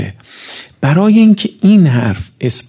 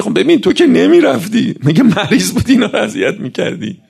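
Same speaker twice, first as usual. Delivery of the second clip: scolding rebuke; 8 semitones higher than usual. Acted. God rebukes those harassing mourners.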